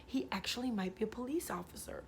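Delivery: hushed, relieved whisper. Retold or imitated. Calming herself about the guide, relieved whisper. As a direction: let it out in a whisper, half convinced. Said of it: "I was like".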